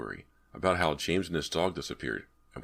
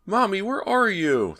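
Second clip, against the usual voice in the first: put-on high, loud child's cry; a lost boy crying out from the dark; crying little boy; 7 dB louder, slower than usual. A narrator's reading. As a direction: call out for mommy, desperate and scared. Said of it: "the voice cried".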